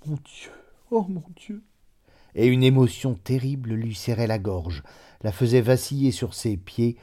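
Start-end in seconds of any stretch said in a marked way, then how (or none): 0.0-1.6 softer, higher whispered prayer; a mother praying under her breath on the road; murmured prayer. A narrator's reading. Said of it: "elle murmurait comme pour une prière".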